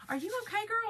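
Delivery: a light take on a worried coworker; neighbor's concern. Retold or imitated. Coworker checking on her, lightly mimicked concern. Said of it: "my neighbor at my office was like".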